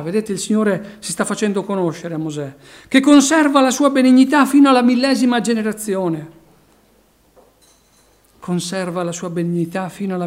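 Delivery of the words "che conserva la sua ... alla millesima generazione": loud, ringing proclamation of the verse; proclaimed loudly. Acted. Preacher proclaiming the verse to the church, loud and ringing. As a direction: preach the line out to the back row.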